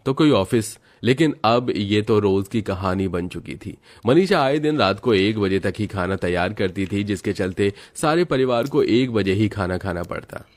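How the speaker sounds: normal talking voice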